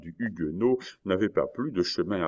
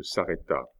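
ordinary delivery

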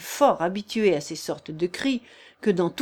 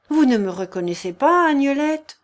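hurt plea; 6 semitones higher, 6 dB louder than usual. Acted. Thibault pleads to be recognised.

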